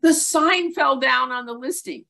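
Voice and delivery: high, loud, rushed breathless gushing; gushing emotional assistant. Retold or imitated. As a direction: spill the news like a frantic assistant. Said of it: "emotional high I assistant who's like".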